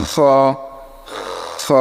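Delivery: খ as a long scraped fricative, then vowel; Arabic kha. Shown as a demonstration. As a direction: rasp খ at the back of the mouth. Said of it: "এরকম". (আরবি খ, ঘষে টেনে)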